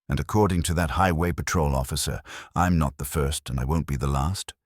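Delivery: plain reading voice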